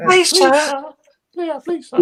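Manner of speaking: high, loud mock English urchin begging; Oliver Twist beggar. Joking about Oliver Twist in an English urchin voice. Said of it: "none of us are in Oliver Twist".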